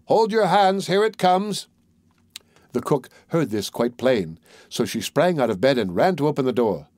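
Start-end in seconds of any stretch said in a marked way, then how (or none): 0.1-1.7 high, sustained storybook call; calling out loud. A narrator's reading. Tom answers the thieves from inside the house, calling out. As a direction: sing out high, as if to people outside. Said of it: "called out as loud as he could".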